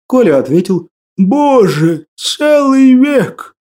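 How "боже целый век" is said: slow, high, despairing cry; anguished sigh. Acted. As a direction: let the century crush him. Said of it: "Тоскливо вздохнул Василий".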